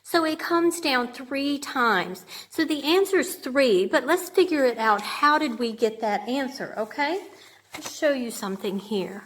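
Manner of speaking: ordinary talk, nothing marked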